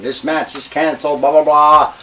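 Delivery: loud, high-pitched retold tirade; shouted rant, re-enacted; retold screaming. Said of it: "and I'm screaming".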